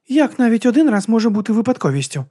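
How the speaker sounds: high, puzzled voice; baffled, lifted question; confused question. A narrator's reading. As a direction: ask in high-pitched bewilderment. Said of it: "збентежено запитала сонечко".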